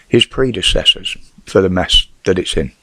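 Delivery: ordinary delivery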